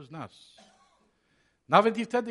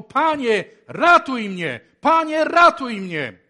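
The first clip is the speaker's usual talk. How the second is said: loud, high, pleading shout; desperate cry for help; 7 semitones higher, 13 dB louder, slower than usual. Acted. Peter sinking in the storm, shouting to Jesus for rescue. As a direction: cry out to the Lord like a drowning man. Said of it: "krzyknął".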